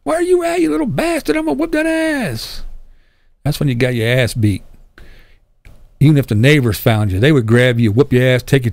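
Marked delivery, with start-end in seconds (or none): 0.0-2.6 very high-pitched mock mom scream; hammed-up falsetto threat from mom; imitating screaming mom. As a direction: screech it high without real volume, like an angry mother calling her kid. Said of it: "Your mom be screaming at you".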